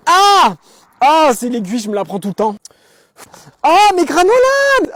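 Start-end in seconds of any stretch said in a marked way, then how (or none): 0.1-0.6 sharp high yelp of pain. Acted. Piquée par une aiguille dans son sac, cri aigu. Pricked by needle in bag. 3.6-4.9 high, loud, slow squeal of joy; delighted discovery. Acted. Retrouvant ses granolas dans le sac, cri de joie aigu et étiré. Finds snacks in bag.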